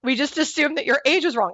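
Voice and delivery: high, loud gushing apology; apologetic clerk. Retold or imitated. Retelling the flustered clerk's apology, high and loud. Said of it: "They go".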